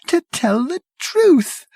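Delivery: tremble with horror; shivering dread. Acted. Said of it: "he paused and shivered".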